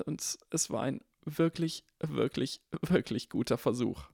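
Harry's voice. Acted. Harry's repeated wirklich. (sanft wiederholtes wirklich, etwas höher)

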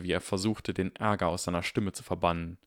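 usual speaking voice

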